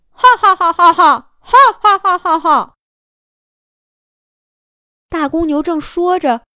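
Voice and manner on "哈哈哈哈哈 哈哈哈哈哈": booming scornful laugh. Acted.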